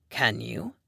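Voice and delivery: low, soft challenge; challenging. A narrator's reading. Dom challenging the narrator, low and soft. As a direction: dare her, low and quiet. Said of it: "he challenged".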